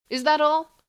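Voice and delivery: can't believe it's over; incredulous. Acted. Warrior incredulous, other voice.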